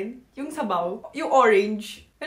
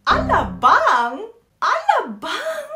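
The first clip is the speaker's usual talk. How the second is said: very high, slow, loud double take; mock astonishment; 9 semitones higher, 8 dB louder, much slower than usual. Reacting to a classmate from Alabang, slow high disbelief. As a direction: stretch it out in disbelief.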